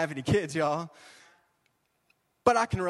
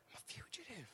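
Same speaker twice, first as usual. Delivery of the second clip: whispered confession; 5 semitones higher, 21 dB quieter than usual. Acted. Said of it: "Just like Moses".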